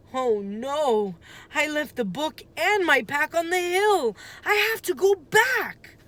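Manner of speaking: high, rising alarm; dismayed cry. A narrator's reading. Boy realizing his book is on the hill, dismayed alarm. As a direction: panic as you realize what you left.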